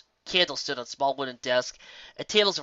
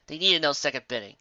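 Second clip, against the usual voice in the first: plain reading voice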